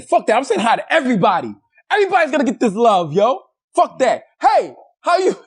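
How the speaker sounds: high-pitched, exuberant vow, acted out; mock defiant. Acting out his defiant inner vow.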